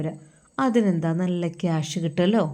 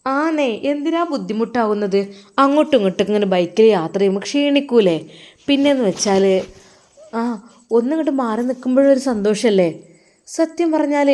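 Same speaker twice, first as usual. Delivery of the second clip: put-on higher, curious young wife; wife asking; 3 semitones higher, 4 dB louder than usual. Wife asks husband why he goes to madrasa, higher voice. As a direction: ask him sweetly, a pitch up. Wife's voice in story.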